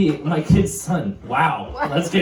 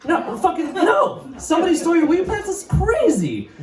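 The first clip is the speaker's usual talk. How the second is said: mock innocent denial; 12 semitones higher than usual. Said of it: "What would you say?".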